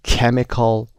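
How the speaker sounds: "Chemical" with CH said as a hard K. Shown as a demonstration. Teacher modelling a related word, "Chemical" with a K. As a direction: say "Chemical" starting with a K sound. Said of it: "به این شکل تلفظ".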